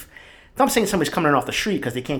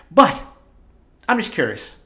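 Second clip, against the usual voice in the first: ordinary talk, nothing marked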